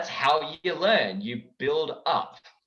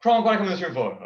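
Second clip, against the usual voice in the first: King Julian voice; 5 semitones higher, 5 dB louder, faster than usual. Acted. King Julian asks Kronk.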